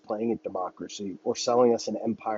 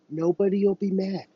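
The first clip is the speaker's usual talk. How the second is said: lightly raised, reassuring parent voice; gentle parent; 7 semitones higher than usual. Pretend. Parent reassuring child, lightly voiced reassurance. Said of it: "Just like a child with their parent".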